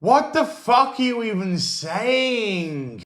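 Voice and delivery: a slow British groan of utter confusion; incredulous outburst. Real, not acted. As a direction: drag it out like you can't believe your ears. Baffled at Riri's logic.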